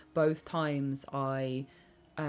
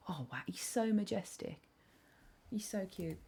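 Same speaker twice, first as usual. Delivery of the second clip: much softer, hushed awe; awed whisper; 3 semitones higher, 8 dB quieter than usual. Real, not acted. Streamer whispers in awe at a crow. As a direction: whisper in wonder at the bird. Watching crows nearby.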